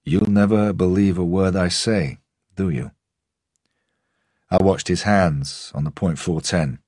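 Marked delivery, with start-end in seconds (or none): 0.0-2.9 a hint of a Russian accent, lightly bitter; old man's understated Russian accent; Russian-English accent. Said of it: "the accent forever caught somewhere between rural England and his Russian homeland".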